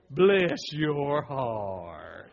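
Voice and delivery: slow, drawn-out Southern "Bless your heart."; Southern phrase. Shown as a demonstration. Pastor showing how Southerners say "Bless your heart." slowly. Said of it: "What's the words we use?".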